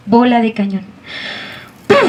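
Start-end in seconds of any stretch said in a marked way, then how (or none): 1.9-2.1 sharp spoken puff of a cannon blast; cannon boom. Acted. Teller makes the cannon's boom for the children, a spoken puff. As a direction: pop it out like a cannon shot.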